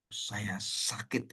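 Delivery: soft, very drawn-out admission; slow right down and drop soft; retold hurt confession. Elder confesses his hurt to pastor, slow and quiet.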